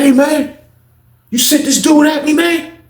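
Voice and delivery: high, loud accusing question; high confrontation. Retold or imitated. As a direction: call him out, pitched high. Confronting Booker.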